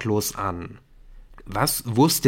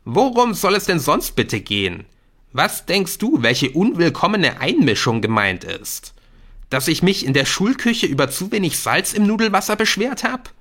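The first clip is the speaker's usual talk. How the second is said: higher-pitched, sarcastic friend challenging him; indignant schoolboy; 7 semitones higher, 4 dB louder than usual. Acted. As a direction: pile up exasperated rhetorical questions. Jamie's indignant questions in the story. (empörter Schüler, spöttisch nachhakend)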